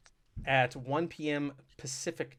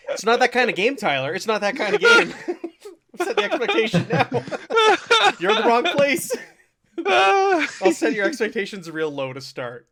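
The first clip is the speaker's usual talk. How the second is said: high, loud, talking through laughter; laughing; 9 semitones higher, 8 dB louder than usual. Real, not acted. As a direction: crack up while teasing the player. Host joking about lowering expectations.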